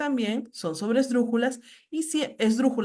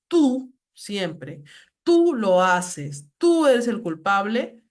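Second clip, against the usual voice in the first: tú said slowly and louder, like pointing a finger; stressed tú; 5 dB louder, much slower than usual. Shown as a demonstration. Teacher showing the class a slow, loud, accusing tú. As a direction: lean hard on each tú, slow and accusing. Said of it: "un poco más fuerte".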